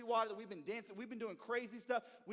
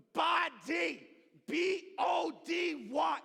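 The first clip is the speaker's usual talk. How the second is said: BODY then B O D Y spelled letter by letter, high and loud; condescending brother spelling B O D Y, loud; 6 semitones higher, 7 dB louder, much slower than usual. Retold or imitated. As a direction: spell B O D Y slowly like to a child. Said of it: "He's spelling".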